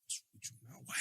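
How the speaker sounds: a hushed, breathless question to her son; mum's whisper. Retold or imitated. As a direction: whisper it like a worried mum. Mum's looking at me like.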